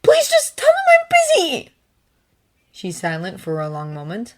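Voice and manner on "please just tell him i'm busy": pleading. Acted. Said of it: "raw and vulnerable".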